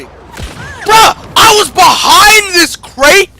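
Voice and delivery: outraged shout. Real, not acted. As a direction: yell in disbelief at the hit. Shot behind cover, outraged.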